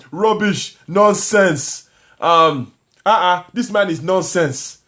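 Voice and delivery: put-on scoffing Nigerian accent, slow and dismissive on the last line. Chatting with Nigerian viewers, scolding in a Nigerian accent. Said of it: "I love doing African accents".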